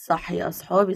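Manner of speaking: ordinary talk, nothing marked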